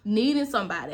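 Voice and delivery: normal talking voice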